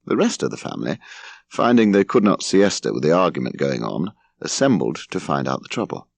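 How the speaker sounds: plain reading voice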